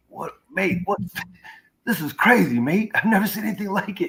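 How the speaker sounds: put-on high, bright-eyed Australian accent, pitched well up. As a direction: do an amazed Aussie mate in a high voice. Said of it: "I love your Australian impersonation".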